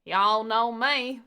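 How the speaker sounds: slow, drawn-out mock American Southern accent; hammed-up Southern drawl, slow and loud. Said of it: "try and do a southern accent".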